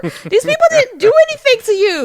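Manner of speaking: loud, very high-pitched mock outrage; mock protest. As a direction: plead their case to the dragon, high and loud. Mock protest to the angry dragon.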